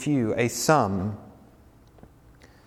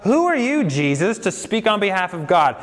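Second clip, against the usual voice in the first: mocking scoffer; 7 semitones higher than usual. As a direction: toss the question up like an outraged critic. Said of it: "They're like".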